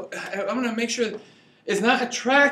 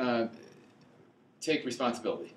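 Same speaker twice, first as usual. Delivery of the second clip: ordinary delivery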